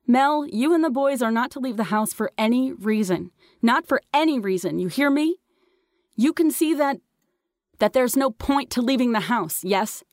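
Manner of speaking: high, insistent mother's voice; urgent motherly call, not shouted; mom's firm instructions. A narrator's reading. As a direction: call up orders that must be obeyed. Mom giving orders from car.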